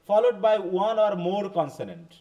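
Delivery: very slow, careful reading of a text; slow classroom reading aloud. Shown as a demonstration. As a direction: read it word by word for learners. Reads English text to class. (ক্লাসে ধীরে ধীরে ইংরেজি পাঠ)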